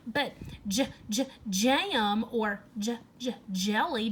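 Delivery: j-, j-, jam or j-, j-, jelly: the j repeated on its own before each word; sounding out the J. Shown as a demonstration. Teacher sounding out jelly for young pupils, repeating the j. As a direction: break off the j sound, then say jam and jelly slowly. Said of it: "does it begin with a J?".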